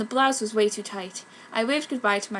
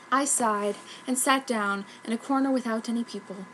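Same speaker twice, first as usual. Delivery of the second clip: usual speaking voice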